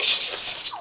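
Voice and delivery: sharp repeated shushing; hushing. Retold or imitated. Kids shushing each other at footsteps outside the door, hushing. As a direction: imitate urgent shushes to silence the others.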